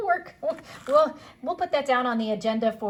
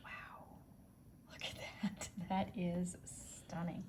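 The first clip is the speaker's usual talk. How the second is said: whispering; 5 semitones lower, 13 dB quieter, slower than usual. Real, not acted. Admiring the lit stone.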